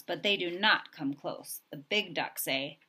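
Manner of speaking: ordinary delivery, nothing marked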